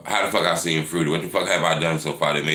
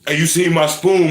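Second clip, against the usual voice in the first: mock scared guy; 11 semitones higher, 7 dB louder than usual. Imitating a scared man asking.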